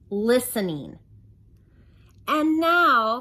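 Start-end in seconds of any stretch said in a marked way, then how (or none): 2.3-3.2 high, loud chirpy opener; bright chatty voice. Acted. Waitress closing up, bubbly and loud.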